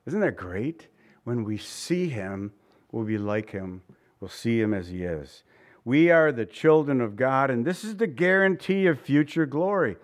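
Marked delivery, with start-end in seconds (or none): 1.2-5.4 hushed awe. Real, not acted. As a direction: let the promise sink in quietly. Restating the verse in awe.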